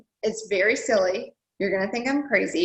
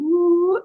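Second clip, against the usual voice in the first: a much higher, louder delighted ooh; 9 semitones higher, 6 dB louder than usual. Real, not acted. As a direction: squeal at the dream house picture. Shows her dream house picture.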